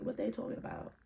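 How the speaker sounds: very low, hushed thinking aloud; puzzled murmur. Retold or imitated. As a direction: murmur it under your breath, deep and quiet. Murmured aside of her thought.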